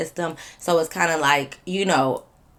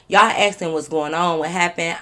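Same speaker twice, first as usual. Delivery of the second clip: ordinary delivery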